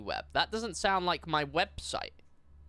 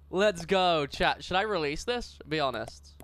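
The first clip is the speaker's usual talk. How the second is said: normal talking voice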